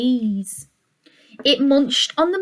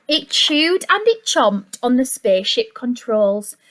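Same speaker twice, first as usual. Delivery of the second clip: plain reading voice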